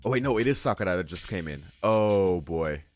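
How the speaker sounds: ordinary talk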